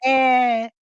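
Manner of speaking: normal talking voice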